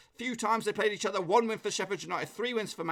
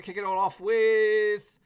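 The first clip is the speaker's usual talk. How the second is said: announcer voice; 4 semitones higher, 7 dB louder, much slower than usual. Acted. Stretched hype intro to predictions.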